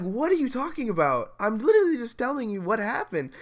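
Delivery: high-pitched exasperated disbelief; incredulous protest. Retold or imitated. Retold incredulous protest to teacher.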